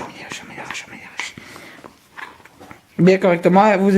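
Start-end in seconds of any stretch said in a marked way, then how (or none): 0.0-1.3 fading into a whispered chant; whispering. Acted. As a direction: whisper it while stirring.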